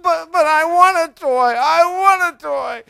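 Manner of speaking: high, loud stammering wail; whining, crying child. Acted. Daughter refused a toy by her father, whining loudly. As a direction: whine and cry like a little girl.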